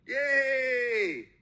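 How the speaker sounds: sarcastic little yay; mock someone celebrating a weak win; hammed-up fake celebration; mock cheer. Mocking settling for low wins.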